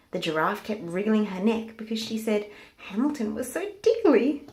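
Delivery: ordinary delivery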